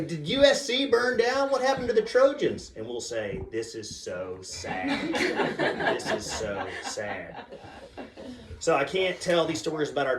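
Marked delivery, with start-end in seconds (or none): 0.0-2.7 distressed daughter. Retold or imitated. Daughter asks about burned college, high distressed voice. 3.5-7.4 very slow, low lament; mock sorrow. Dad misleading daughter about college, slow mock sorrow.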